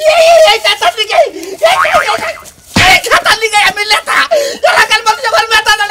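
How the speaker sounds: high, loud, hammed-up sobbing speech; tearful wailing. Leader crying that the quilt made him leader, tearful wailing. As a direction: bawl and stutter through the lament.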